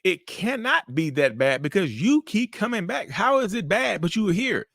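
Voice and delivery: narcissist voice. Acted. Narcissist denying mistreatment to the one who came back, indignant protest. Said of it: "That's the mind of a narcissist".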